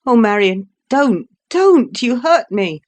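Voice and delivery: high, loud cry of pain. Acted. Laura gripped too tightly by her sister, crying out in pain. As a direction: cry out as she's crushed.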